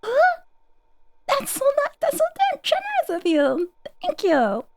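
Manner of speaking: squeaky shocked thanks; high-pitched disbelief at the gift; high surprised. Real, not acted. Surprised by big donation.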